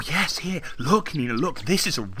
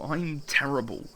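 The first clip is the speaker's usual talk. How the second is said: usual speaking voice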